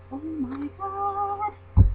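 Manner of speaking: high, very drawn-out squeal; slow, high-pitched mock swoon; fangirl swoon. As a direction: stretch it out high, overcome with delight. Re-enacts overwhelmed fangirl reaction.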